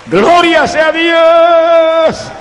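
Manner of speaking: high, drawn-out shout of praise; exultant preacher's shout. Real, not acted. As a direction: cry glory at full force, stretching the last word.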